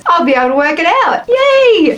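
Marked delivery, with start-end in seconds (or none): 1.3-2.0 high-pitched, playful yay; excited cheer. Real, not acted. Vlogger pleased she can work out measurements, excited cheer. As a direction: give a little high squeal of delight.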